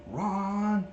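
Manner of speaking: high sing-song grandma call; grandmother calling. Retold or imitated. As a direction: call him the way grandma did. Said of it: "That's how my grandmother used to call me".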